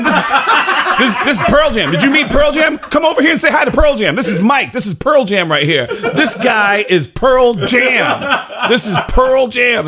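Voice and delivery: loud, high, excited shout; boastful announcing. Retold or imitated. Introducing a famous friend backstage at intermission, boastful shouting. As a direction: show off the famous friend to all.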